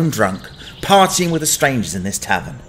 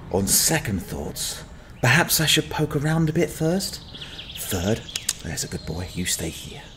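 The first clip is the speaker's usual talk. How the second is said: much softer, careful and hushed; cautious hush; 9 dB quieter than usual. Acted. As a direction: keep it down so the dragon won't hear. Sneaking toward dragon's cave.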